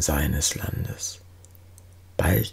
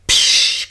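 lautes, stimmloses Zischen; 7 dB louder than usual. A narrator's reading. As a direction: zischen wie Teig in der heißen Pfanne. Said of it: "kommt herüber mit Gezisch". (lautmalerisches, kräftiges „psch“)

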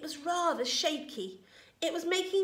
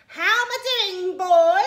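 high, very loud grandma's shout; 9 semitones higher, 14 dB louder than usual. A narrator's reading. Grandma calling down from the roof to George, shouting. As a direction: bellow it out, high and triumphant. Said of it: "she shouted".

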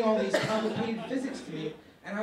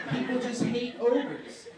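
laughing through the line; amused aside, laughing; 4 semitones higher, 4 dB louder than usual. Real, not acted. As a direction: chuckle it out.

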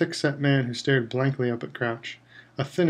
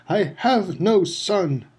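higher, slow, cold rage; slow furious denial; 6 semitones higher, much slower than usual. A narrator's reading. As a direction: spit out each word. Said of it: "bellowed Mister Crouch".

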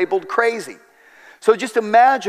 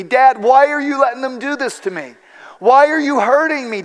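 put-on higher, louder desperate child's plea; pleading child; 7 semitones higher, 3 dB louder than usual. Little daughter pinned down, begging her dad: pleading cry. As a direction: beg like a terrified little girl. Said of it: "as she pleaded".

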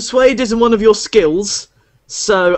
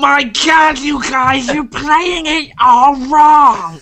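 higher, nasal-free mock nerd shouting, slow at the end; 6 semitones higher, slower than usual. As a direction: whine like a pedantic nerd. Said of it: "you should have done that in your nerd voice".

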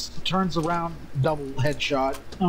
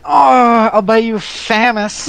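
loud eager offer; 5 semitones higher, 13 dB louder, slower than usual. Real, not acted.

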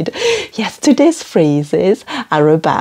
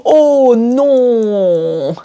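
dismayed French no; 4 semitones higher than usual. Shown as a demonstration. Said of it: "À toi".

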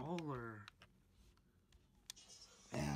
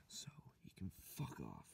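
whisper; 12 semitones lower, 6 dB quieter than usual. Acted. Whispered insult.

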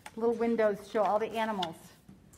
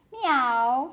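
louder meow called down; cat calling down; 3 semitones higher, 7 dB louder than usual. Acted. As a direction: meow down from a tree. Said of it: "he called down".

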